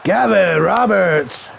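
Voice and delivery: slow, drawn-out name call; ring announcer. Pretend. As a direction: introduce the wrestler with stretched syllables.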